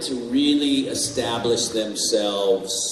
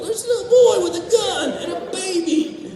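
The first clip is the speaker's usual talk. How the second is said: high, laughing, incredulous report; retold disbelief; 4 dB louder than usual. Telling Vincent about the boy, high and laughing. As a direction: blurt it out high, cracking up. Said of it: "I go".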